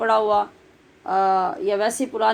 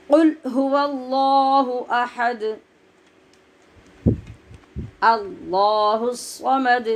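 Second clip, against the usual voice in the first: slow, drawn-out tajweed chant; slow Quran recitation; much slower than usual. Shown as a demonstration. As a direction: recite each word long and measured. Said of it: "recitation demo".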